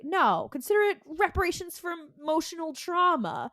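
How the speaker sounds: mock smug little brother; smug taunt. Sam taunting Dean.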